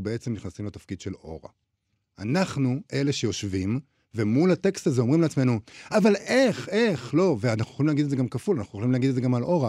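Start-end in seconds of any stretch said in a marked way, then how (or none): 5.9-7.4 high, loud indignant "אבל איך"; mock tut-tutting. Reader judging the mother, mock sanctimonious tutting. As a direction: play the self-righteous reader clucking in disbelief. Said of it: "אותו צקצוק".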